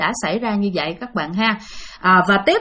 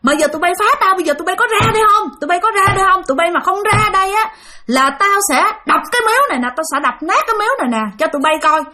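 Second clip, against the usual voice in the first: retold cursing; 8 semitones higher, faster than usual. Father threatens the ghosts at the shrine, furious yelling. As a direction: yell at the spirits who pranked you. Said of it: "Bà mới đứng bà chửi nha".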